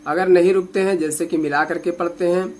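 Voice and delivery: normal talking voice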